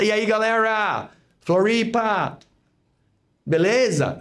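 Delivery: hyped-up crowd greeting, imitated; rallying the crowd. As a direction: greet a whole audience, imitating an event opener.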